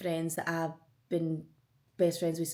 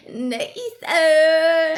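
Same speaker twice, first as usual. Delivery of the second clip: higher, louder name through laugh and tears; laughing half-sob; 8 semitones higher, 12 dB louder than usual. Real, not acted. Vlogger overcome thinking of her best friend, laughing through tears. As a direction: cry out his name, laughing and tearful.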